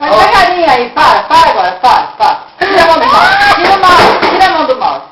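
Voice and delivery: loud urgent orders; shouting. Real, not acted. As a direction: yell it, high and loud. Urgent commands during game.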